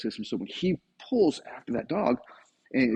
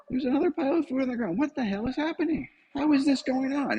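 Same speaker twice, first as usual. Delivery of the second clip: baffled dog; 12 semitones higher than usual. Retold or imitated. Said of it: "dog voiced baffled".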